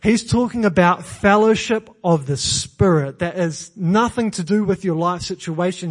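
ordinary talk, nothing marked

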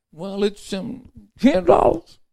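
high, hesitant, slowed admission; husband admitting the price to his wife, high and hesitant; sheepish old husband. Acted. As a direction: own up to the price reluctantly.

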